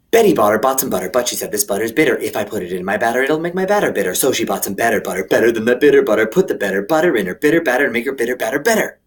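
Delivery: tongue twister rattled off quickly; fast. Shown as a demonstration. Doing the tongue twister super fast for the kids. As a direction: race through the twister. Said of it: "really super fast".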